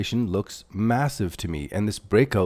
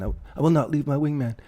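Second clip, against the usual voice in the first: higher, hammed-up movie pilot voice, unlike his own; Top Gun impression; 6 semitones higher than usual. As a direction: ham up the wingman vow like a movie hero. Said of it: "a Top Gun wingman".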